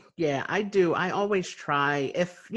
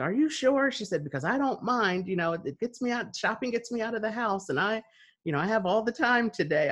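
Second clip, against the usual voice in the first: put-on sweet old lady; 7 semitones higher than usual. Elderly woman insisting.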